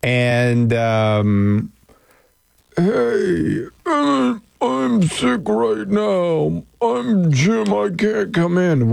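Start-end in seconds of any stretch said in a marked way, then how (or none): 2.8-8.9 high, strained phone-in sick act; badly faked sick call to the boss; fake sick voice. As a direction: put on a feeble sick voice, pitched high. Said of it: "fake a good sick voice".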